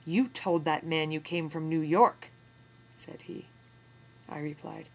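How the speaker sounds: put-on steady, measured reading of an old memoir; story narration. A narrator's reading. Teacher reading a memoir passage to students, in measured narration. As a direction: read the passage to the class with weight.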